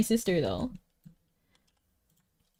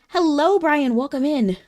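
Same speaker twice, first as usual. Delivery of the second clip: higher and louder welcome for a viewer; excited greeting; 7 semitones higher, 6 dB louder than usual. Real, not acted. Streamer welcoming viewer Brian into chat, loud and bright. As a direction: call out a cheery hello.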